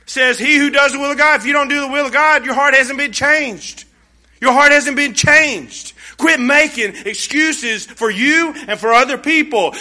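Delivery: loud, high, fervent preaching; full-force pulpit warning. Acted. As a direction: drive it home to the congregation. Preacher's climax.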